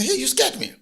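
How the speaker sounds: high startled yelp of a frightened woman. Acted. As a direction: jump back in fright, voice shooting up. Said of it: "she got scared".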